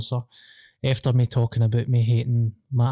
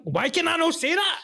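high-pitched exasperated squeal; baffled at your own tongue, pitch shooting up; real squeaky frustration. Real, not acted. Keeps fumbling the phrase.

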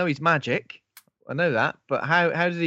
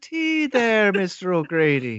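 leprechaun as wife; 9 semitones higher than usual. Retold or imitated.

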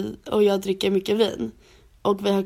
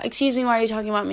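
mock confrontation; faster than usual. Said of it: "då kan jag vara så här".

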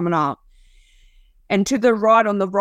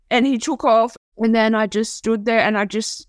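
usual speaking voice

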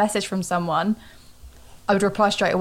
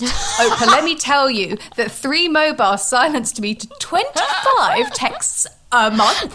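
higher, louder, slow and laughing through it; mock dramatic confession; 6 semitones higher, 4 dB louder, slower than usual. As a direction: ham up a tearful interview answer. Pretend answer to Oprah, laughing.

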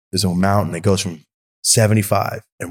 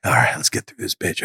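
whispered to self; 4 semitones lower, 4 dB quieter than usual. Retold or imitated. Sneaking through airport, to self.

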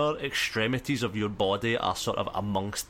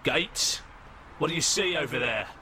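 higher-pitched call to a colleague; mild acted shout, raised in pitch; shouting back; 6 semitones higher than usual. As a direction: call across the alley as an officer. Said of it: "the other man shouts back and says".

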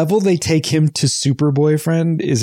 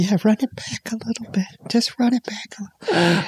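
hushed whisper, slightly higher; 5 semitones higher, 5 dB quieter than usual. Pretend. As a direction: whisper the joke back. Whispered joke reply.

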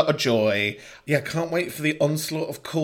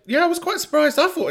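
surprised; 5 dB louder than usual. Real, not acted. Recalls the city.

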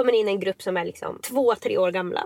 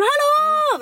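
high, loud holler to someone; calling out; 5 dB louder than usual. Acted. Parent wanting her child to spot her in the audience, calling out. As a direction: sing out a big, high hello. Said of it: "vill jag ropa".

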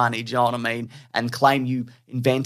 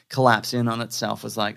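ordinary delivery

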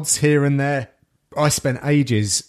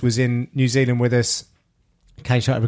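normal talking voice

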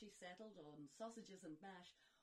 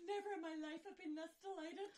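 high, fast gushing joy; squealing with delight; 8 semitones higher, 6 dB louder, faster than usual. Acted. Sister overjoyed at invitation.

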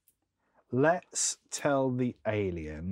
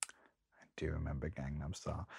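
whisper; 7 semitones lower, 12 dB quieter than usual. Real, not acted. Whispered reply.

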